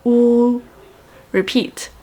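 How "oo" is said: "oo" as one long, steady sound; vowel demo. Shown as a demonstration. Teacher modelling letter U for learners, "oo" held long and steady. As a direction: hold "oo" evenly, a touch raised. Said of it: "It has a sound of".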